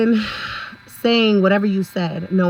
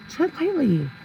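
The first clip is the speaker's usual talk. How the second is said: pitched-up, teasing caution; high-pitched warning; 7 semitones higher than usual. Real, not acted. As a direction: TREAD LIGHTLY in a much higher voice. Repeats the warning for emphasis.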